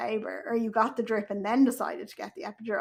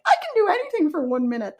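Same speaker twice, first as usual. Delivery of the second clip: mock upbeat mantra; 7 semitones higher, 5 dB louder, faster than usual. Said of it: "people are like".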